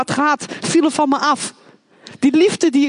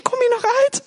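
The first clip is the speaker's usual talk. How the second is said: mocking taunt high; 12 semitones higher than usual. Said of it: "Ik zei".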